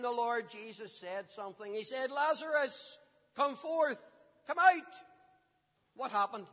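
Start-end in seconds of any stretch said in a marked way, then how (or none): 2.1-4.9 calling out. Acted. Preacher voices Jesus calling Lazarus.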